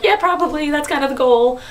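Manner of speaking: can't hide her excitement; giddy reply. Retold or imitated. Answering coworker, giddy.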